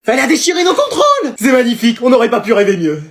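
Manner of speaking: high, loud cheer of pure joy; excited shout. Real, not acted. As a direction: yell it out in delight. Schüler jubeln über zerrissenen Ehestreit.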